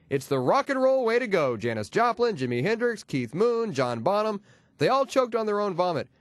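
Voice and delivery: ordinary delivery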